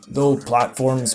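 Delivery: usual speaking voice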